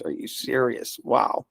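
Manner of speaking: ordinary delivery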